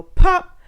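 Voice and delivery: high, loud pop; popping sound. A narrator's reading. Sound effect word in story.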